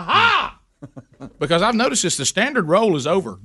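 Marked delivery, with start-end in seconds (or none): 0.0-0.6 high, squealed Aha! shot way up; big aha. Pretend. Cued by a friend, shrieking Aha! in triumph. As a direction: crow the Aha! like a revelation. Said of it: "Somebody say, "Aha".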